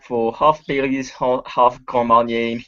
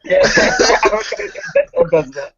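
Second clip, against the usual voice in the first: laughing; 4 dB louder, much slower than usual. Real, not acted. Player laughs at own character.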